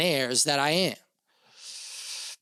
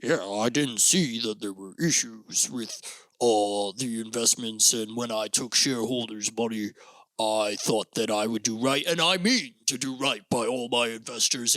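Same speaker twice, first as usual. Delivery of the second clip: fake sincere crook; 4 semitones lower than usual. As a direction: play the embezzler promising to make it right. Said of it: "No, it's more like".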